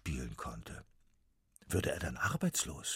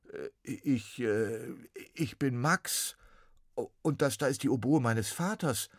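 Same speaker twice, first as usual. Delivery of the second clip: put-on higher, halting boy voice with ums; stammering boy; 6 semitones higher, 4 dB louder than usual. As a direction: stutter it like a flustered boy. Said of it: "stotterte Max".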